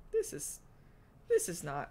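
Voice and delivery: usual speaking voice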